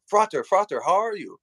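high, bright call of a nun greeting a brother; cheerful sister greeting. Retold or imitated. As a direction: sing out the greeting like a friendly sister. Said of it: "she said".

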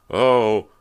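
loud sudden startled oh; officer startled as the skull clatters; startled cry. A narrator's reading. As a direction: yelp as something slips. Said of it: "An officer uttered a cry".

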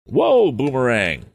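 high, slow, loud call to the mule; crying out. A narrator's reading. Old man calls his mule to stop, loud slow cry. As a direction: haul the mule back with your voice. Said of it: "cried the man".

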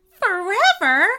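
high, loud shocked question on "Forever"; gasped. A narrator's reading. Boy echoes the imp's sentence, gasping in shock. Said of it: "the boy gasped".

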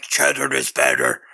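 low cartoon goblin pitching cheese; goblin puppet voice. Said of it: "this goblin puppet is like".

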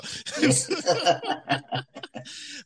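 high burst of laughter. Real, not acted. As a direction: crack up. Laughing at the fan.

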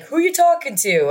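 mimicking dad's question; gruff dad. Mimicking dad asking who she talks to.

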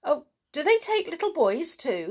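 put-on anxious small-boy question; alarmed. A narrator's reading. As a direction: ask with a touch of worry. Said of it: "Sandy felt quite alarmed".

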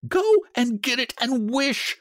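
high, excited command; quivering excitement. A narrator's reading. Wife orders husband to wish, quivering with excitement. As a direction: cry it out, urging him on. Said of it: "quivering with excitement".

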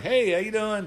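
lightly voiced upbeat greeting; chirpy hello as the star; imitating Shawn. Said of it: "And he's like".

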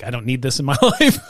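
amused retold protest, laughing at the end; laughing self-quote. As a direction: laugh through the retold complaint. Laughs at own retold complaint.

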